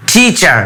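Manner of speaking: টিচার with a breathy ঠ-like th at the start; aspirated T. Shown as a demonstration. Student teacher showing classmates the right sound, aspirated th. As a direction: puff of air on the ট of টিচার. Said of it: "এটার উচ্চারণটা হবে".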